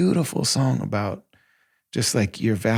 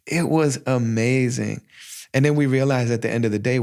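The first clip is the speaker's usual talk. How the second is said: usual speaking voice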